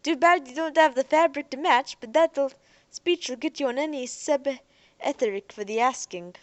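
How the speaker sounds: put-on loud, high, slangy street-kid voice; cheery young Earther. Young man teases the spacers, loud and high-pitched. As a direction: keep the young man's jaunty, loud banter going.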